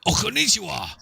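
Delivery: high hammed-up martial arts villain; kung fu movie villain. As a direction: ham up a seventies kung fu baddie. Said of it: "come at me with that kind of voice".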